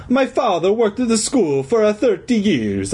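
high cartoon Italian accent; old Italian janitor telling his history. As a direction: explain it in hammy Italian. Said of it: "in his hoarse Italian voice".

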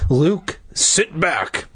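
firm, mildly exasperated dad; growled order. A narrator's reading. Dad finally giving up, mildly growling. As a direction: order him back with a touch of growl. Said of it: "Dad growled".

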